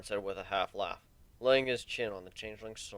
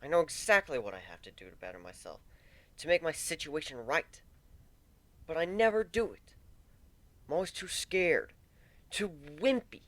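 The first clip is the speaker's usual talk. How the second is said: higher, pained self-disgust; Warren, self-loathing; 6 semitones higher than usual. Acted. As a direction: spit it out against yourself, rising in pitch.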